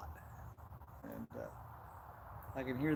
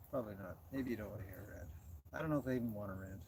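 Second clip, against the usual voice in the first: muttering; 6 semitones lower, 8 dB quieter, faster than usual. Real, not acted. Answering own question, deflated.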